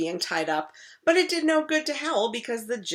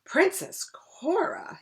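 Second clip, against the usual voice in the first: sad voice; 10 semitones higher, slower than usual. Acted. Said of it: "in the sad voice".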